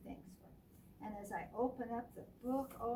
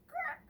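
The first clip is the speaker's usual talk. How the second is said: high, squeaky crack; crash sound. Acted. Falling plate cracking, high sound. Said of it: "it says".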